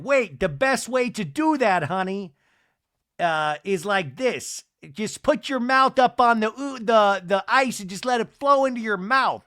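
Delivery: loud, high coaching voice; imitated eager instructor. Seth showing Meredith the ice luge, loud imitated coaching.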